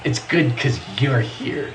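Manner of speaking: slow, soft, low teacher voice; eerily nice classroom praise; creepy-nice kindergarten teacher. Acted. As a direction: stretch it out, sweet but unsettling. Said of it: "slightly creepy, nice inflection".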